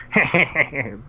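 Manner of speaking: mock snicker, mimicking your co-caster; imitated sarcastic laugh. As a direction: imitate his sarcastic chuckle. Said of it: "throwing something sarcastic, and he's like".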